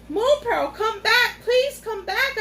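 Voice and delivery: high, loud pleading call into the trees; desperate calling. A narrator's reading. Boy calls after the lost unicorn, pleading loud shout. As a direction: shout after her, begging her to return. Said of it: "I cried".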